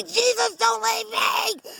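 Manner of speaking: high, slow, whimpering plea; begging her friend not to leave; frightened wail. Real, not acted. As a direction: cry it out, scared. Friend leaving her with birds.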